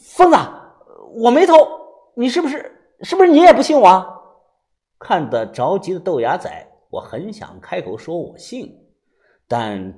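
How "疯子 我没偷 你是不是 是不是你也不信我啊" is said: high, loud, desperate questioning; agitated shout, speeding up at the end; loud demand. A narrator's reading. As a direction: demand belief at full volume. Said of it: "大声地问我".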